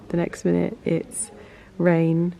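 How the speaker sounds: ordinary delivery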